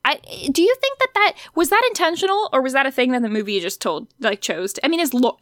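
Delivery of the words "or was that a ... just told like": ordinary delivery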